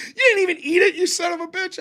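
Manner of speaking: high, laughing mock fury; hammed-up outrage cracking into laughter; livid. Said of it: "She was fucking livid".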